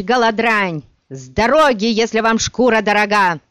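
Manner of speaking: furious shout at a mob; angry shouting. Acted. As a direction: roar it, full fury.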